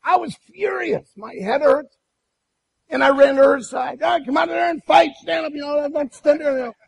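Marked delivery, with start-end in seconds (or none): 4.0-6.6 screaming challenge. Retold or imitated. Husband screams at wife behind window, furious. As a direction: dare her to come out and fight. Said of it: "words to scream at her".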